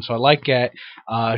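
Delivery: normal talking voice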